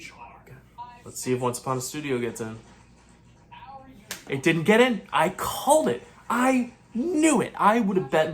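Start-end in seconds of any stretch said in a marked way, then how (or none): 4.3-8.3 high, loud "I knew it"; triumphant. Real, not acted. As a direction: gloat over calling it. Triumphant about a called prediction.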